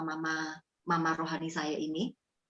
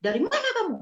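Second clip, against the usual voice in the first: higher, louder scolding demand; snapping at her; 13 semitones higher, 4 dB louder, faster than usual. Retold or imitated. Brother-in-law on the phone demands where she was, angry. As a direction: bark the question at her. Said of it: "Saya dibentak gitu".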